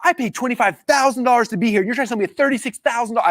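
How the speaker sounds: high-pitched, incredulous outrage; indignant retold objection; livid. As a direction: protest like you've just been ripped off. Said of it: "I was, like, livid".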